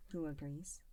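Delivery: ordinary delivery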